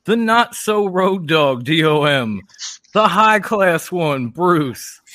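loud drawn-out ring introductions; ringmaster. Acted. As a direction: call out the champions slowly. Introducing the tag champions.

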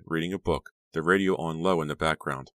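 usual speaking voice

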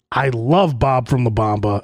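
ordinary talk

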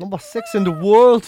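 loud, slow proclamation; mock triumphant shout. Co-host joins chart boast, shouting triumphantly. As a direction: boom it out to the world.

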